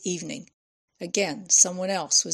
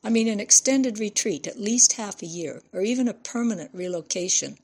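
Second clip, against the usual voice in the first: ordinary delivery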